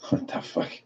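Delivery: voiceless stunned whisper; whispered disbelief. Retold or imitated. Reacting to chat at four in the morning, whispered. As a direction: breathe it out as a whisper. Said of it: "I'm just like".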